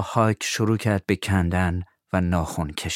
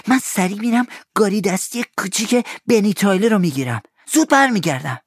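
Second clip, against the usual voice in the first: put-on higher eager boy; Tom's boyish voice; 11 semitones higher, 3 dB louder than usual. Tom asks Huck to help find the money, eager. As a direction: sound young and keen.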